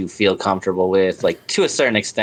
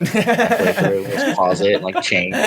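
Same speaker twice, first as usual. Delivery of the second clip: laughing; slower than usual. Real, not acted. Joking about changing clothes.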